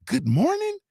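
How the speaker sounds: very high, disbelieving echo; mock-appalled squeal; incredulous. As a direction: question it as if it's absurd. Appalled repeat of her complaint.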